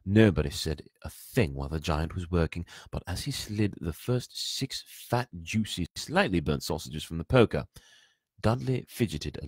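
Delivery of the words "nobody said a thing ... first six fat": usual speaking voice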